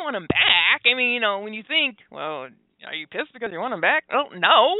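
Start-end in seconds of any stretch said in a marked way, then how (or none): none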